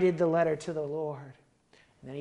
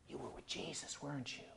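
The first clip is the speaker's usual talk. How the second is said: very soft, hushed accusation; servant girl's question; 15 dB quieter than usual. Retold or imitated. Servant girl accusing Peter by the fire, hushed. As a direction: quietly catch him out. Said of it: "the little servant comes up".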